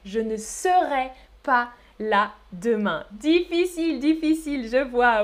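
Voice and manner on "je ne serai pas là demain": je ne serai in separate syllables; slow full form. Shown as a demonstration. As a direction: sound ne and serai fully, slowly. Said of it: "c'est le verbe être". (« je ne serai » lentement, en entier)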